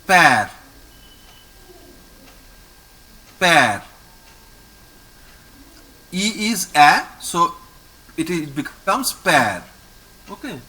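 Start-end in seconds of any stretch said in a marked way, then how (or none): 0.0-3.8 pear as loud, falling "pehr"; French pronunciation demo. Shown as a demonstration. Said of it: "so we pronounce it like". 9.1-9.6 pear as "pehr", open è; French pronunciation. Shown as a demonstration. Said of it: "so we pronounce it like".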